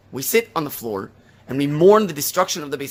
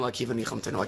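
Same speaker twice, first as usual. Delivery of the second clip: much softer, lower, grateful echo of "Akiva nichamtanu"; hushed repetition; 6 semitones lower, 9 dB quieter, faster than usual. Retold or imitated. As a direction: let "Akiva nichamtanu" sink to a whisper of thanks. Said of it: "how did they respond".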